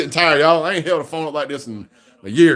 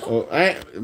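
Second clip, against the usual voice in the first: ordinary talk, nothing marked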